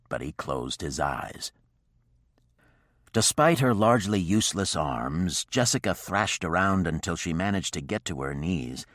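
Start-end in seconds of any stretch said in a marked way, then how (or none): none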